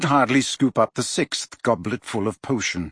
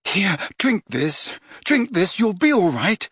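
desperate; 8 semitones higher than usual. A narrator's reading. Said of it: "said Harry desperately".

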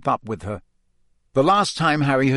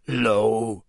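very hoarse; 2 semitones lower than usual. A narrator's reading. Hagrid greeting Harry after crying, very hoarse. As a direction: croak it out, voice worn from crying. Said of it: "in a very hoarse voice".

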